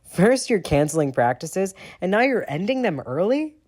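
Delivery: loud, rising accusation; demanding. A narrator's reading. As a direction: press him hard. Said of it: "Sirius demands".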